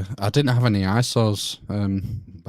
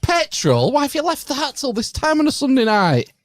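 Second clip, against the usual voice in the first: mock outraged; 4 dB louder than usual. Real, not acted. Mock outrage at late petrol.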